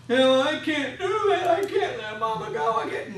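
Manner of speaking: a touch of desperate denial; pleading relative. Retold or imitated. Family member at the bedside refusing to let Mama go, pleading.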